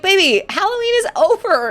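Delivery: put-on high, loud telling-off to a toddler; sing-song coaxing of a toddler; gently high mom voice. Mom telling her two-year-old Halloween is over, high and sing-song. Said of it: "I'm like".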